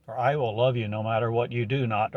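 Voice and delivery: ordinary talk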